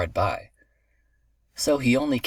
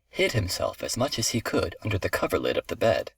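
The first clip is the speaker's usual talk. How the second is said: ordinary delivery, nothing marked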